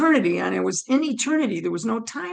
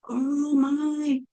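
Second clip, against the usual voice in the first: drawn-out, higher hush of wonder; awestruck, as if seeing God; retold awe; 5 semitones higher than usual. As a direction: breathe it out in awe, stretched. Awed at first sight of God.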